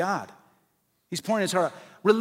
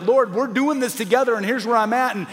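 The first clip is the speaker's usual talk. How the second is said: honest venting prayer; 5 semitones higher, 4 dB louder than usual. Shown as a demonstration. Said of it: "you're just telling the Lord".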